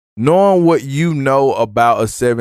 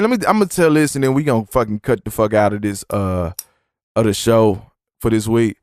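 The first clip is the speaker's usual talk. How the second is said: normal talking voice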